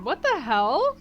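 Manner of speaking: normal talking voice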